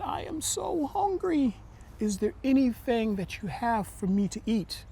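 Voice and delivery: put-on a frail old lady begging for food. A narrator's reading. Hungry old lady at the door.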